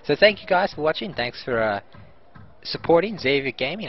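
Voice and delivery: ordinary delivery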